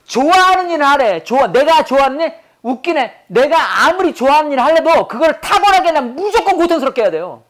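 heated, emphatic lecturing with real annoyance; worked up. Real, not acted. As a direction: throw the idea back in exasperation. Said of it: "또 승질나".

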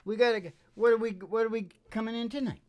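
usual speaking voice

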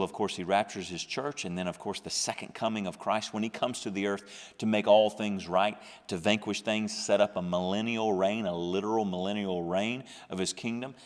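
normal talking voice

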